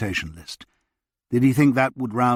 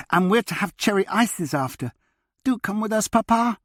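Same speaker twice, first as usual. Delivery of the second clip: put-on high, bright child's voice pleading; a small girl tugging at her papa to come along; eager little girl; 11 semitones higher than usual. Little girl begging her papa to join the outing, high eager child voice.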